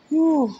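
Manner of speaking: sharp high exhale, mouth on fire; high whew from the heat. Real, not acted. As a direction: blow out the burning heat. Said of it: "that is hot".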